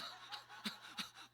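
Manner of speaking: short burst of voiceless laughter; laughing. Real, not acted. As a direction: break into a real laugh. Laughs after asking about dad. (터져 나오는 웃음)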